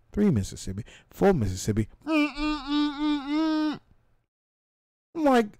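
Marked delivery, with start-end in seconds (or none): 2.1-3.8 impatient humming. Retold or imitated. Waiting behind a stalled truck.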